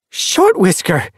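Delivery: high, loud, drawn-out exclamation; surprised gasp. A narrator's reading. Cat leader recognises an old clanmate, surprised gasp. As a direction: cry the name in sudden recognition. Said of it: "Leaf Star gasped".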